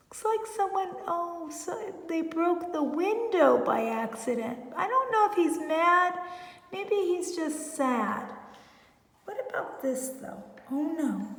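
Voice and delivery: ordinary delivery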